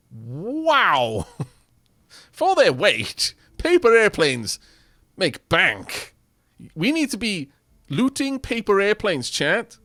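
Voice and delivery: loud, thrilled discovery; hyped up, loud and slow; excited. Real, not acted. Thrilled at airplane prices.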